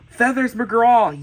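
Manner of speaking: gasp, then a loud, high, slow exclamation; excited fan gasp and drawn-out name; thrilled. Real, not acted. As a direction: announce a favorite character with relish. Thrilled at a favorite character.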